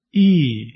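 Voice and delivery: ای as a long, isolated "ee"; long close front vowel for ای. Shown as a demonstration. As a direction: say ای drawn out as "ee". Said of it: "هم کشش داره".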